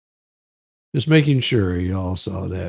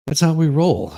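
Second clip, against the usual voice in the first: hyped sign-off; 8 semitones higher, 6 dB louder than usual. Real, not acted. Closing out the stream.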